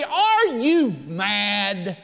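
drawn out in disbelief; the praying church doubting Rhoda, slow and incredulous; slow incredulous question. Acted. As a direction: stretch it out like she's lost it.

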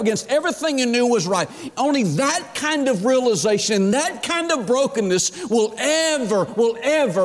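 high, insistent, building pulpit cry; full-force preaching. Acted. Preacher describing horrified repentance to congregation, impassioned preaching.

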